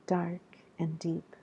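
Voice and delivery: hushed, breathy whisper on the line. Acted.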